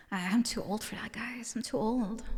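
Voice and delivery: hushed embarrassed whisper. Real, not acted. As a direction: whisper it sheepishly to yourself. Embarrassed denial, whispered aside.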